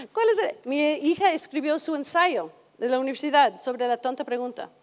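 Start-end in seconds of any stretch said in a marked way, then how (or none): 0.1-0.6 high, baffled mock question; children confused by the form, pitched up; puzzled. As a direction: imitate a kid stumped by the question.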